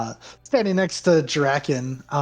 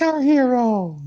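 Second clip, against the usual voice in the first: cheering cry; 11 semitones higher, 5 dB louder than usual. Acted. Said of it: "Another person's like".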